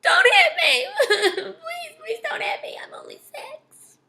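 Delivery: high wail fading into whimpered pleading; hammed-up crying. A narrator's reading. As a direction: act a little kid bawling, then squeaking through sobs. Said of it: "Daniel started to cry".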